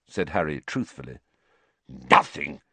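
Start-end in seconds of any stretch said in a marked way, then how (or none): 1.9-2.6 spat. Acted. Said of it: "spat Filch".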